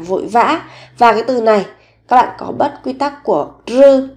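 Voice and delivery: ordinary talk, nothing marked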